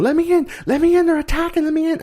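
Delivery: very high, frantic mock plea; panicked pleading. Horace pounding on the shop door, pleading in a very high voice. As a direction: beg to be let in as the monster attacks. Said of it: "Horace was coming over going".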